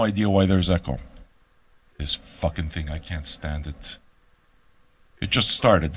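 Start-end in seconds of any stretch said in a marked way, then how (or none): 2.0-4.0 low, soft grumbling at the gear; muttered curse. Real, not acted. Host annoyed at the echo, a low soft grumble. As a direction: grumble under the breath, much softer.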